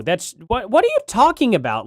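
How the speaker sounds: ordinary talk, nothing marked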